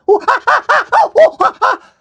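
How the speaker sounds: shrieking high chimp hoots, shouted; chimpanzee noise. Pretend. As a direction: hoot like an excited chimp. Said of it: "and one went".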